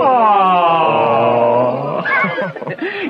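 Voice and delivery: loud teasing laugh; a big mocking laugh at a friend. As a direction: laugh at his gloom. Mercutio mocks Romeo's lovesickness.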